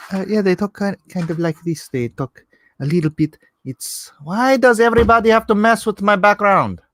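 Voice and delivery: put-on Argentinian accent, rising into a high, loud complaint; imitated Argentinian accent. Shown as a demonstration. Streamer showing how Argentinian friends talk, Argentinian accent. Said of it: "what do my friends from Argentina talk like".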